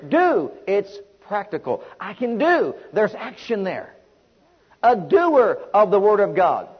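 normal talking voice

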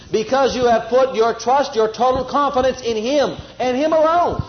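ordinary talk

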